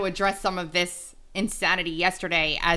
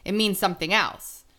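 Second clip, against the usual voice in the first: ordinary delivery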